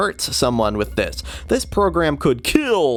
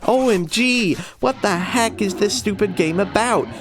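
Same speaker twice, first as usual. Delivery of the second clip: put-on high, outraged teen squeal; shocked high voice; 8 semitones higher than usual. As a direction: squeal it in disbelief. Shocked commenter read in high voice.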